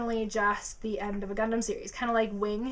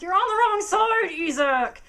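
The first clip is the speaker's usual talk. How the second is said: high, loud fan yell; yelling at screen; 9 semitones higher, 6 dB louder than usual. Retold or imitated. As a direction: shout at the character to switch. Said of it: "I'm like".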